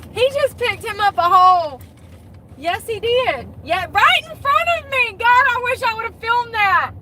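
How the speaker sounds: high-pitched, excited disbelief; worked-up, incredulous squeal of words; real astonishment. Real, not acted. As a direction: exclaim it high, thrilled at what you just witnessed. Astonished at witnessing a pickup.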